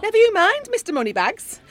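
high, loud teasing brush-off. Acted. Collector teasing Darren about his pay rise, high playful. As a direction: bat the question away playfully.

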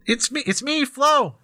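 high, muffled call from behind rocks; muffled yelling voice. Acted. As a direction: call out from behind a rock wall. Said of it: "a muffled voice yelling from behind the rubble".

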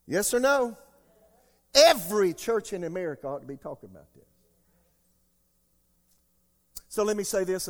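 loud, high call to the congregation; full-force preaching. Acted. Preacher calling on his church, loud and high. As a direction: demand an answer from the crowd.

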